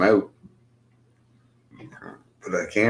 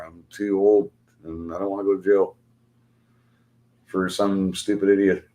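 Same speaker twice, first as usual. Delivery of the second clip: ordinary delivery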